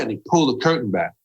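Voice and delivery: ordinary delivery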